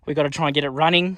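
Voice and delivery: normal talking voice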